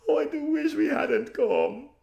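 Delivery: very high, loud, slow moaning; regretting it in fear; frightened wail. Acted. Terrified Lasaraline.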